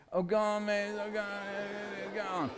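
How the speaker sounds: thin whinging beg; extra whingy. Acted. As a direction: wheedle like a whiny child, fading away. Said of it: "Extra whingy bit".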